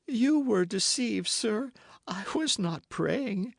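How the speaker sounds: a touch of feigned sobs; voice broken by sobs. Acted. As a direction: choke it out as if sobbing. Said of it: "in a voice broken by sobs".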